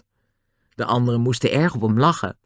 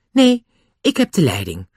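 irritated, higher-pitched reply; annoyed; 4 semitones higher than usual. A narrator's reading. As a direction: assert charge with annoyance. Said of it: "zei Julian nu geërgerd".

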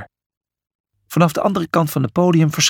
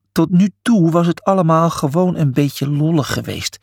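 plain reading voice

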